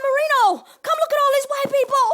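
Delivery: loud, high, fast excited call. Retold or imitated. Calling to her husband at the window, loud and excited. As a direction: call him over in amazed delight.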